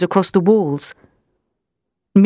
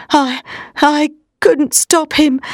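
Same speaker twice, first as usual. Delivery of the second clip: weak, halting voice; 6 semitones higher, slower than usual. Acted. Madman weakening, dying.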